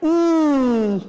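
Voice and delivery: wide smiling ee, loud; loud ee demo; loud stretched ee. Shown as a demonstration.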